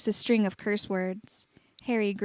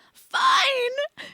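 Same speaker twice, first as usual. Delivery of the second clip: shrieking high wail; 4 dB louder than usual. A narrator's reading. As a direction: fling the word out in a screech. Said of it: "she wailed".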